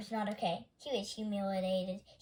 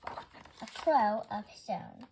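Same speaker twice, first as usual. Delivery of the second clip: ordinary delivery, nothing marked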